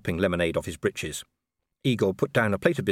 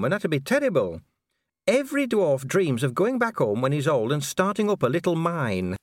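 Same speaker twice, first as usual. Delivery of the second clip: high-pitched, earnest dwarf officer; earnest Cheery; 12 semitones higher, 4 dB louder than usual. Acted. Dwarf officer explaining burials to Vimes, earnest. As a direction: explain it anxiously, pitched well up.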